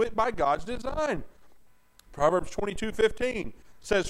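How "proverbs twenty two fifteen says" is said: normal talking voice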